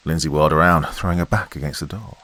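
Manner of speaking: usual speaking voice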